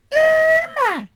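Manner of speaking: very high, loud shrieked name; squawky female cry. Acted. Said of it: "my generic squawky female voice".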